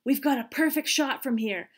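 loud, conspiratorial stage whisper. A narrator's reading. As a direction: plot it with relish. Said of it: "whispered to the group".

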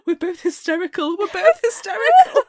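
high, loud laughing exclamation; shrieking with laughter. Real, not acted. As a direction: crack up at yourselves. Laughing self-mockery.

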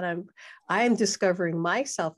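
ordinary talk, nothing marked

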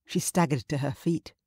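usual speaking voice

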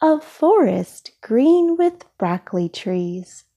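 usual speaking voice

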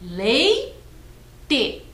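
"Leite" split into LEI, pause, TE; syllable by syllable. Shown as a demonstration. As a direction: say Leite as two separate clapped beats. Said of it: "você conta as sílabas, batendo palmas". (Leite sílaba por sílaba: LEI, pausa, TE)

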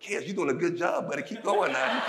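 a touch of friendly encouragement, still a bit loud; sudden sweet coach. Retold or imitated. Coach switches from yelling to praising Kez, kindly. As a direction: switch to a kindly pat-on-the-back tone, laughing. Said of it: "He switched it up".

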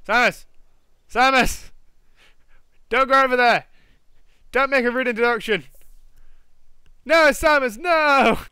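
loud, high pleading yell; frantic shouts at a sim; yelling at Samus. Real, not acted. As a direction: call out to stop her, rising in panic.

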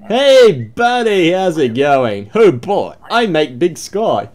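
high, loud boastful buddy voice; loud cheerful entrant. Acted. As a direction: hail the guard like an old pal.

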